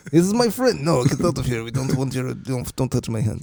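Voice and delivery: badly faked Russian accent; mock Russian accent. Podcaster playing a soccer star refusing a fan's touch, mock Russian accent. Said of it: "I just go straight Russian every time".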